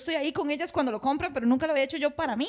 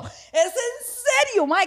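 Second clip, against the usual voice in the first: incredulous; 10 semitones higher, much slower than usual. Retold or imitated. Outraged at the bank.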